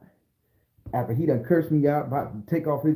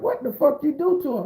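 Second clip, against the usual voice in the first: high-pitched acted bailiff; incredulous question, voice way up; alarmed bailiff; 12 semitones higher than usual. Bailiff questioning him in court, acted alarm. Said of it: "And the bailiff like".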